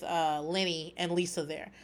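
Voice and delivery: ordinary talk, nothing marked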